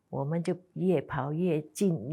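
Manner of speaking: ordinary talk, nothing marked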